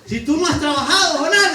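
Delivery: loud, high rebuke with a laugh in it; a hint of God scolding, chuckling. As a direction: scold Jonah as God, half amused.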